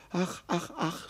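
high, soft acted sighs; sighing. A narrator's reading. Hurt deer moaning to the marmot, sighing softly. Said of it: "seufzte das Reh".

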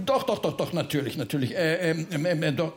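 stammered string of reassurances, sinking in pitch; flustered backtracking. Acted. Bertha placates the offended Freiherr, flustered. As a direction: backpedal and fumble for words. Backtracking, cannot recall the instrument.

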